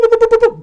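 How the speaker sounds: high, loud stuttering boops; quick-fire mouth noise; rapid imitated noise. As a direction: rattle off high-pitched sound effect. Imitates guys suddenly surrounding him.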